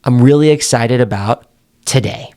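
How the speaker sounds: deliberate pause and low finish; pause before last word. Shown as a demonstration. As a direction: hold a beat before "today", then fall. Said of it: "You pause and then you lower your tone".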